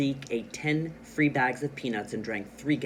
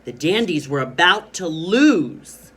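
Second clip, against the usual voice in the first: loud, slower, high-pitched excitement; excited reading; 4 semitones higher, 11 dB louder than usual. Shown as a demonstration. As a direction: build alarm loudly over the coming loss. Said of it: "read the first line with excitement".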